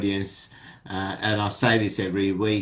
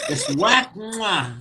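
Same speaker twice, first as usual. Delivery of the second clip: slap and kiss sounds; 9 semitones higher, slower than usual. Retold or imitated. Mimicking slap then kiss.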